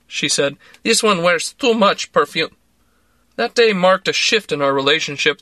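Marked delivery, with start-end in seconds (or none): 0.8-2.5 put-on higher, dismissive Russian-accented jab; catty Russian aside. A narrator's reading. Larissa disparages Misha's girlfriend, a higher, dismissive Russian-accented jab. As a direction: sniff at the rival in a Russian accent.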